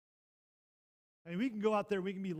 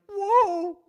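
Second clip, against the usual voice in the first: huge, high, loud cry of amazement; a stunned shout of joy at the crowd; amazed whoa; 14 semitones higher, 15 dB louder than usual. Retold or imitated. Amazed at packed church.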